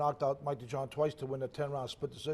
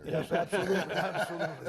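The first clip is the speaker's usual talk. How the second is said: laughing; 3 semitones higher, 4 dB louder than usual. Real, not acted. Laughs while agreeing about date.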